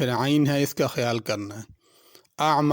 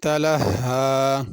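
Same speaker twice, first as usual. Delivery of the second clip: slow, drawn-out melodic tajweed chant; Quran recitation; 3 dB louder, much slower than usual. Acted. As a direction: recite slowly with long stretched vowels.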